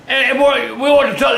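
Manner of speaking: ordinary talk, nothing marked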